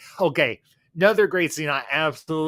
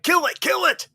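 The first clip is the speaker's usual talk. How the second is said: high, loud squawk of alarm; urgent high-pitched acted cry; mock panic; 13 semitones higher, 5 dB louder than usual. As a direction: yelp it out in hammed-up panic. Acts out Jay's panic.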